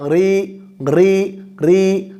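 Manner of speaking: ری repeated softly, thin with zer. Shown as a demonstration. Teacher drills thin ri with zer. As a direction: say ری light and thin, three times. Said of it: "زیر ہوگی".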